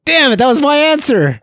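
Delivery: loud, high exasperated cry; frustrated outburst. Real, not acted. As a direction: shout it like your answer was stolen. Someone took his answer.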